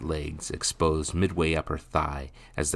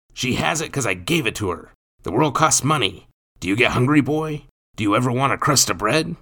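loud, raised berating voice; angry stepfather; 8 semitones higher, 7 dB louder, faster than usual. A narrator's reading. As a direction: lecture the boy loudly and harshly.

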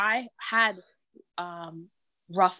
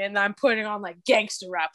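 ordinary talk, nothing marked

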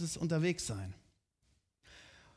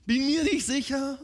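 high, hesitant mock doubt, a bit louder; doubting people; 5 semitones higher, 5 dB louder, much slower than usual. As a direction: play the unsure people, higher. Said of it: "Denkt so".

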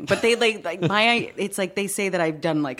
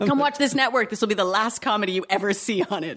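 mock network promo; 5 semitones higher than usual.

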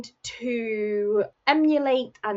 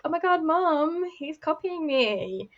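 put-on high, whiny kid tattling to mom; whiny tattling little sibling; 6 semitones higher, slower than usual. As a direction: whine it like a bratty little sibling. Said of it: "they were like".

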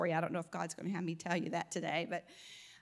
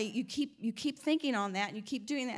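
normal talking voice

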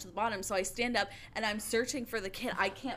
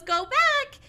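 much higher, louder mom voice; imitating the mom; 9 dB louder than usual. A lifeguard mimics the mother sending her son back to the pool, high-pitched. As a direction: shoo the kid back like a brisk mother.

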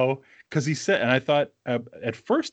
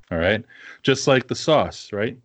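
ordinary delivery

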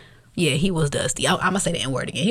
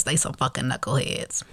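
ordinary talk

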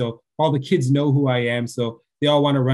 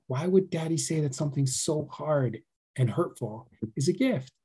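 gentle, soft teaching; 2 semitones higher, 4 dB quieter than usual. Retold or imitated. Father gently teaching daughter.

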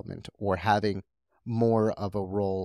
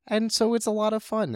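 excited high voice; 12 semitones higher, 4 dB louder than usual. Real, not acted. Excited bunny play story.

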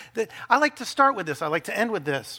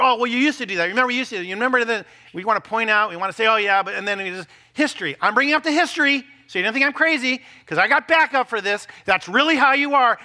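higher, louder mock nagging partner; nagging spouse; 5 semitones higher, 6 dB louder than usual. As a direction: rattle off old grievances. Spouse listing past wrongs.

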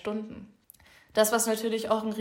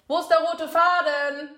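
higher, louder, exasperated call; loud exclamation; 8 semitones higher, 8 dB louder than usual. Real, not acted. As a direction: call out in playful despair. Lost her thread, calls out. (laut und hoch ausgerufen)

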